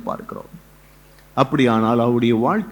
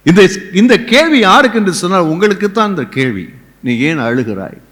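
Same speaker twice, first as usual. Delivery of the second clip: ordinary delivery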